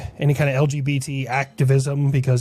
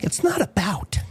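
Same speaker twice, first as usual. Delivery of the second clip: whisper; 4 semitones higher than usual. Acted. Whispered suggestive line.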